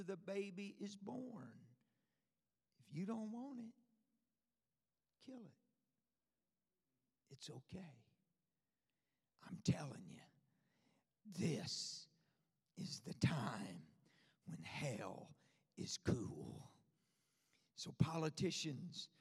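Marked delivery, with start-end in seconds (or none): 2.8-7.9 a soft hushed reassurance. Acted. Hushed aside before whisper. 9.5-16.7 secretive whisper, much lower and softer; a hushed warning to the congregation. Real, not acted. Confiding hushed aside to congregation.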